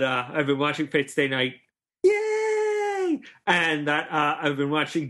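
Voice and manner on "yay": high, drawn-out cheer; sustained high-pitched hooray. Real, not acted. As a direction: squeal a long happy cheer. Cheers the show just named.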